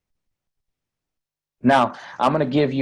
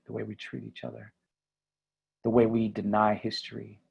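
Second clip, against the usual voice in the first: ordinary delivery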